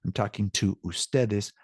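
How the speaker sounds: ordinary talk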